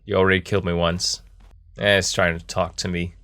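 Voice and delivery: ordinary delivery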